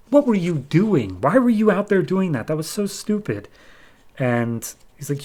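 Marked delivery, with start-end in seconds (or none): 0.1-3.5 scolding. Retold or imitated. Ex-wife scolding him.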